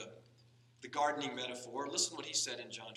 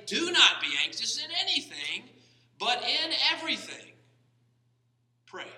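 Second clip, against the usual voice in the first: proclaiming Paul's words; 11 semitones higher, 13 dB louder than usual. Acted. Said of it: "What does Paul say?".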